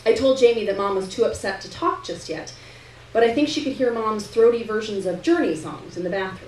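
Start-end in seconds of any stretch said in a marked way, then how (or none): none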